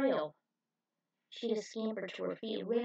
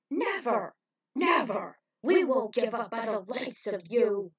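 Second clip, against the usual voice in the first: loud, fierce refusal; defiant shout; 8 dB louder than usual. A narrator's reading. Hero defies the villains, shouting.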